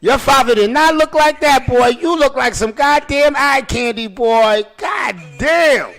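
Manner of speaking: put-on high, loud older lady voice; hammed-up, high-pitched auntie voice; imitated older women. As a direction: mimic an older woman exclaiming over a young man. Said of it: "they look at me and go".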